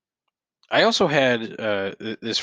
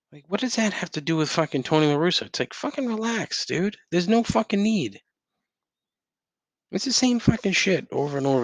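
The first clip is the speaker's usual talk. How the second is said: higher, fast, incredulous outburst; exasperated rant; 8 semitones higher than usual. Real, not acted. Ranting about a reply to his Tony La Russa tweet, exasperated and fast. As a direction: let the disbelief spill out fast.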